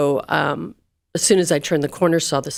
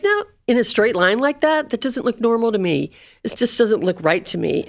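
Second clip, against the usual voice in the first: pitched up, settling as it goes; disbelieving objection; 6 semitones higher than usual. Retold or imitated. Daughter retelling her reply to a consoling worker, disbelieving. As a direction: retell a protest, starting high.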